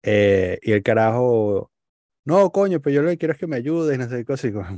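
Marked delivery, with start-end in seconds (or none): 2.2-4.0 higher, fast pushy plea; insist, higher and rushed; mock insistence. Stranger insisting, retold. (insistencia exigente, aguda y rápida)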